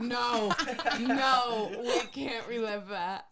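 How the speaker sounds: laughing, pleading no through giggles; laughing protest. Real, not acted. To her co-host about the iPad memory, laughing protest. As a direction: beg them not to bring it up, laughing.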